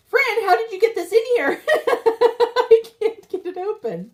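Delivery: high, helpless laughing complaint. Real, not acted. Asking Fran about the packaging she can't open, laughing.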